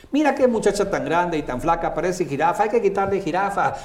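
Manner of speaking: high, fast mocking voice; quick mocking friend. Friend mocking a tall girl, quick high jeer. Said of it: "lo hago muy rápido".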